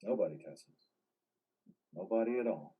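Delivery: very soft, withdrawn answer; subdued denial. Acted. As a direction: deny it quietly, almost to yourself. Covers up talking to voices.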